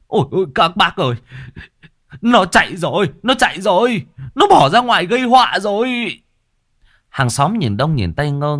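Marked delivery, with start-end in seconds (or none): 0.1-6.2 high, loud, fast panicked yell; screaming for help. A narrator's reading. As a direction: cry out to the neighbours. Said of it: "gào thét với hàng xóm". (ông Chí gào thét gọi hàng xóm)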